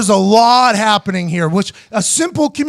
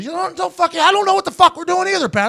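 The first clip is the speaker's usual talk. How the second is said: flustered coach; 9 semitones higher than usual. Retold or imitated. Coach flustered being yelled at.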